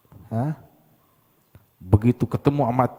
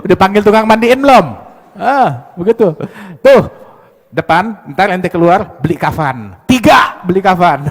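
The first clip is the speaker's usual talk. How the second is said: loud, high mock orders; mocking doctor; 3 semitones higher, 9 dB louder than usual. Rude doctor telling patient to buy shroud, loud. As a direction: bark out burial errands.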